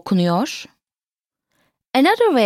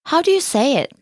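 ordinary talk

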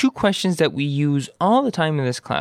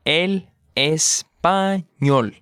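slow model; much slower than usual. Shown as a demonstration. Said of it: "slow model".

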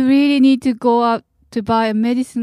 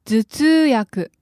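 over enunciating; much slower than usual. Shown as a demonstration. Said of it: "first take slow".

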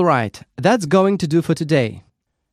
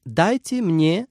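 slow model phrase; 3 semitones higher, slower than usual. Shown as a demonstration. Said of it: "You can simply say".

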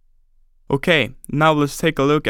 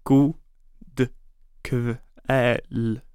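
"God", a pause, then "kväll" drawn out and softer; broken into syllables; 3 dB quieter than usual. Shown as a demonstration. Teacher breaking the evening greeting down for learners, slow and syllable by syllable. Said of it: "Let's break it down by syllable".

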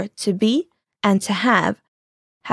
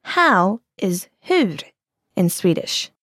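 slow clue; 3 semitones lower, slower than usual. Shown as a demonstration. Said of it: "Here's a clue".

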